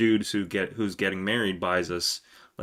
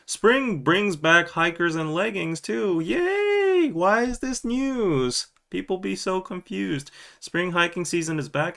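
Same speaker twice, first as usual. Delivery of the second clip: high sing-song, slowed, fake-excited; mock cheerful headline voice; 9 semitones higher than usual. Host reading a silly news topic, mock excited. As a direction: cheer the headline like a squealing fan.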